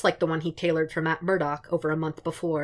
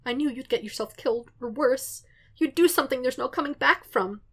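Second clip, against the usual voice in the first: higher, tearful and pleading; tearful goodbye; 8 semitones higher than usual. Acted. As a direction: say it through held-back tears. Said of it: "that same tearful voice".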